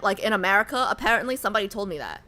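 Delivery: ordinary talk, nothing marked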